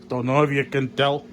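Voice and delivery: ordinary delivery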